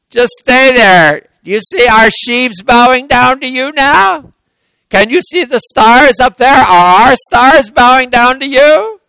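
high, loud mocking jeer; taunting. Brothers jeering down at Joseph in the pit, taunting. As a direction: throw his dream back at him, gloating.

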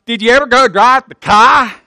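lightly imitated Boston accent; mock Boston talk, dropping the R in car; a touch of exaggerated Boston. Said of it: "that's how those Boston people talk".

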